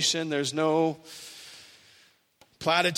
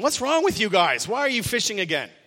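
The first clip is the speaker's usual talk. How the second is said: mock yell; 5 semitones higher, 4 dB louder than usual. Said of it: "Doesn't yell out at them".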